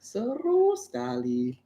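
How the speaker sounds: slow, high mock British accent; British-accented Indonesian. Shown as a demonstration. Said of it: "tapi aksennya Inggris".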